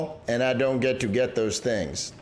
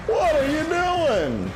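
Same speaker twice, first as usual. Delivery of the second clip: slow, high, startled question; surprised; 13 semitones higher than usual. Real, not acted. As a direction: sound genuinely thrown. Reacting to game event.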